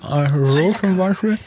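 put-on drunken slurred speech; slurring. Shown as a demonstration. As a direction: slur it like a tipsy drinker. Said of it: "falls wir auch gleich lallen".

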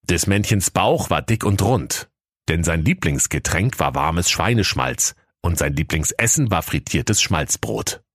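ordinary delivery

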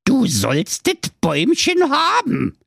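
high, slow, sly dwarf voice. Acted. The evil dwarf slyly grants Marco the tree. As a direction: draw it out cunningly. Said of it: "sagte der böse Zauberzwerg listig".

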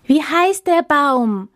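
high, slow eager cry of hungry animals; many animals crying out high and slow; hopeful shout. Acted. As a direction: call out full of hope, drawn out. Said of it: "schrien sie voller Hoffnung".

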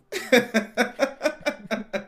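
high, loud laugh; chuckling out loud. Real, not acted. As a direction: laugh ahead of the punchline. Laughs before the airport story.

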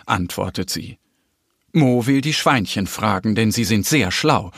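usual speaking voice

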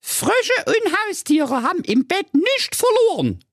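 very high squeaky scolding; outraged fairy-tale girl; indignant princess Carola. Acted. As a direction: lay down the law in a piping voice. Voices Carola objecting.

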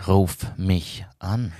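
slow, seductive ad voice; sultry hotline voice. Retold or imitated. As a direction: breathe it like a hotline ad. Mimicking a sex hotline ad.

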